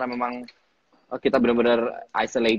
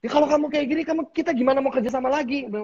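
much higher mock voice of the partner; imitating him; 13 semitones higher, faster than usual. Retelling partner's harsh remark, high mock voice. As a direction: mimic the partner's complaint in a high voice. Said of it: "terus dia bilang".

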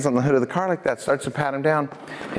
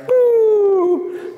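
high, loud siren hoot; imitated siren; 6 dB louder than usual. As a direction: wail a sustained high siren note.

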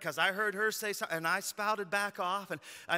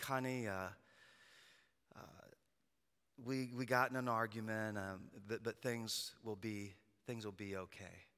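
soft, lower, gentle comfort; reassuring dad; 8 semitones lower, 10 dB quieter than usual. Retold or imitated. Dad comforting his teary daughter, soft and low. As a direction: hush her worries tenderly.

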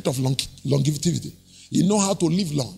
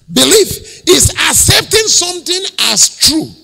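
1 preached shout; 8 semitones higher, 5 dB louder, slower than usual. Acted.

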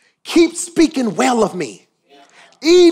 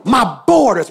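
full-force preaching; 6 semitones higher than usual. Real, not acted. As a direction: drive it home to the room.

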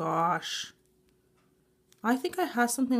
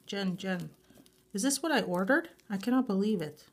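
normal talking voice

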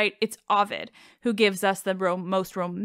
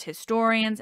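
usual speaking voice

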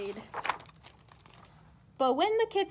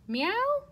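kitten meow; 7 semitones higher than usual. Retold or imitated. Narrator imitates kitten.

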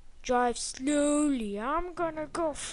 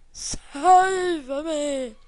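loud plea; 4 semitones higher, 8 dB louder than usual. Real, not acted. Pleading at game.

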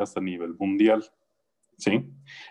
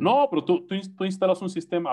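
mock objecting relative; 7 semitones higher than usual.

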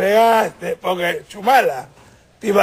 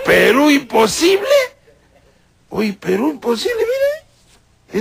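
shocked cry; 6 semitones higher, slower than usual. Acted. Expelled politician wailing in shock. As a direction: cry out the name in dismay.

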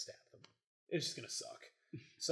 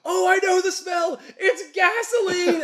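high, loud, slow panicked yell; hammed-up henchman realizing the trap; panicked realization shout; 11 dB louder, slower than usual. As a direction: shout it in mock horror as the trap springs. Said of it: "one of them's like".

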